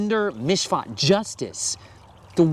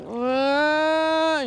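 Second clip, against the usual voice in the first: long, high, droning mock whine; complaining whine; 12 semitones higher than usual. Parent imitating children's grumbling, a complaining whine. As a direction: imitate grumbling as one drawn-out high noise. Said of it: "Grumbling and complaining and".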